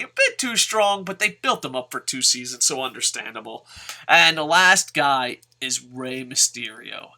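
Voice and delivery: usual speaking voice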